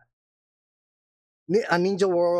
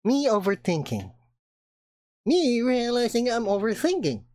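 ordinary talk, nothing marked

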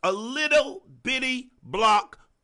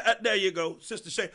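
usual speaking voice

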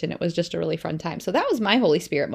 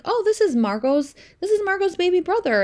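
put-on higher, bright explaining to a child; higher mother voice explaining to her daughter; sing-song mom; 11 semitones higher, 4 dB louder than usual. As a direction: talk up to a little kid. Mom answers child, retold.

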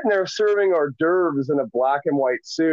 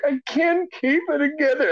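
higher-pitched helpless plea; helpless protest, retold; 6 semitones higher than usual. Caterer told to keep it together, high helpless protest. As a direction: imitate losing control, voice pitched up.

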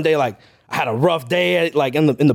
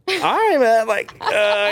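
high-pitched, hesitant mock cluelessness; mock unsure stalling; 6 semitones higher, much slower than usual. Podcaster imitating two beginners staring at each other, high and unsure. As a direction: stall in a high voice, acting lost. Acting out clueless first meeting.

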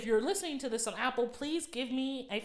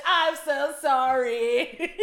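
slow, louder, hammed-up sobbing plea; mock emo crying; 5 semitones higher, 8 dB louder, much slower than usual. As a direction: ham up a weepy emo lament. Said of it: "mocking emo lyrics".

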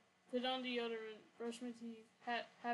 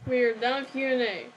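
much louder, firm declaration; loud announcement; 14 dB louder than usual. Real, not acted. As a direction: cut in loudly to close the segment. Calls out end of segment.

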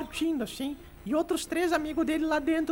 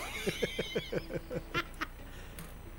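long breathy cackle at own joke; laughing; 4 semitones higher, 10 dB quieter than usual. Real, not acted. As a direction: burst into a mostly voiceless, high laugh. Ri da própria piada de padre. (gargalhada longa e soprada)

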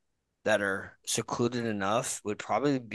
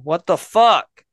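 high, loud yell at the cat; startled yell at a cat at the door; 11 semitones higher, 11 dB louder than usual. Real, not acted. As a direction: shoo the cat away with a shout. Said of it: "Me yelling at the cat".

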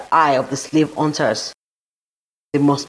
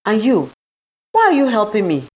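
higher, insistent questioning; 7 semitones higher, 3 dB louder than usual. A narrator's reading. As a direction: press the helper for a reason. Suspicious heroine questions her helper.

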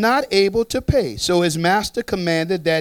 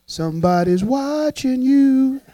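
ominous warning; 7 semitones higher, slower than usual. Pretend. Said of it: "look at your neighbor, say".

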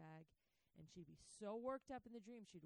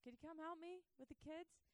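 high, pleading call for help; calling out to husband; 10 semitones higher than usual. Retold or imitated. Mom calling husband from the house, high and pleading. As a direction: call across to him, begging. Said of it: "she calls out to him".

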